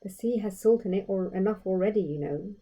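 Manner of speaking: ordinary delivery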